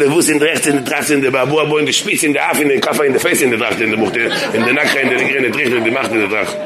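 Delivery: fast rattled-off fake Yiddish; fast mock Yiddish babble; mock Yiddish gibberish. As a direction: spout pseudo-Yiddish nonsense quickly. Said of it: "עכשיו אני אגיד".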